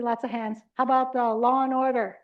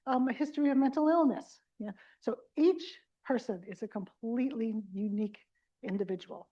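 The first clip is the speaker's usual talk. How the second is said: ordinary talk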